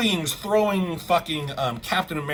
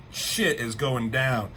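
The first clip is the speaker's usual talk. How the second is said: ordinary talk, nothing marked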